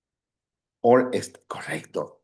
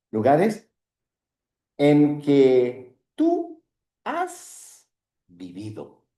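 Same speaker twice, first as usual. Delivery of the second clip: slow modeling; 2 semitones higher, slower than usual. Shown as a demonstration. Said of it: "¿Sí?".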